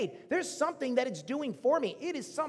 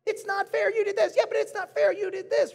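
put-on squabbling kids; 9 semitones higher, 4 dB louder than usual. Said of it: "the Fair Fairy shows up".

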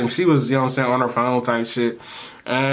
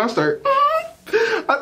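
high, slow words breaking into laughter; can barely speak for laughing; laughing fit; much slower than usual. Real, not acted. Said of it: "I'm sorry".

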